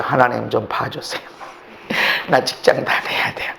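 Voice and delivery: "하나님 좀 봐주세요" said low and soft, barely voiced; a timid murmured plea; soft, low begging prayer; ordinary quiet prayer. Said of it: "그냥 그전엔 기도했죠".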